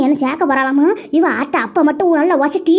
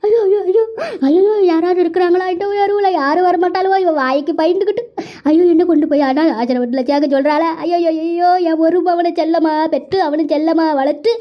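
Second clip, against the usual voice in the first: loud wailing lament. Acted. Mother-in-law crying to the neighbours about being thrown out, wailing. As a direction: cry out for help, wailing through every phrase. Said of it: "என்னம்மா அழுதுகிட்டு இருக்கிற?".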